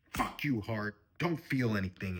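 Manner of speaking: sudden loud burst at oneself; mock angry outburst. Curses own heart, mock outburst.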